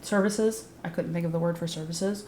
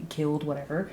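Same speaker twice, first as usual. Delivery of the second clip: ordinary talk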